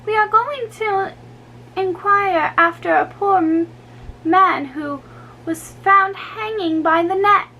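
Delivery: a pert dancer relishing gossip; saucy girl. A narrator's reading. Dancer gossiping to the Count, high and saucy. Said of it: "the brat with a saucy curtsy".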